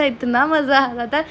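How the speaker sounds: slow, drawn-out इतना मज़ा with a rise; lingering, delighted मज़ा; stretched इतना मज़ा. Real, not acted. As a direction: savour इतना मज़ा slowly. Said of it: "मुझे इतना मज़ा आ रहा था".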